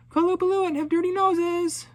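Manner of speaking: high, loud mocking yell across the ice; yelled taunt. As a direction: shout it to provoke the monsters. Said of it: "stood beside the sea ice and yelled".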